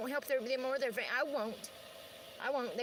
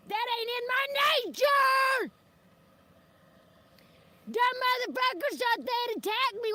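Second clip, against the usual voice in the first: slow, shouted defiance, much higher and louder; defiant shout; 10 semitones higher, 7 dB louder than usual. Real, not acted. Ranting at the people who lied about her, shouting. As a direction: throw it back at them, slow and loud.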